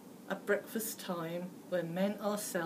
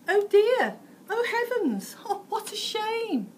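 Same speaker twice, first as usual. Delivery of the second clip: put-on much higher, prissy nice-girl voice with a laugh; prim exclamation; 13 semitones higher, 8 dB louder than usual. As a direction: play a proper little girl gasping in dismay. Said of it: "A nice girl would at once exclaim".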